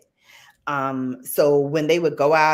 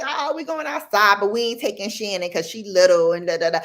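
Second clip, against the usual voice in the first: high voiced cousins leaving her behind; high, teasing kid voices; mocking cousins; 6 semitones higher, faster than usual. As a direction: play the older kids brushing her off.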